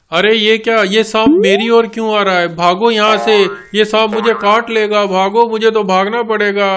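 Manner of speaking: high, loud panic as the snake comes; panicked. Acted. Father panics as the snake comes toward him, loud and high. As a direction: cry out and run for it.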